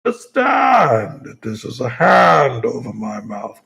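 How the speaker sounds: slow, muffled masked villain; hammed-up Bane through a mask. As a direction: talk through a hand over your mouth. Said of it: "I can do the voice a little better".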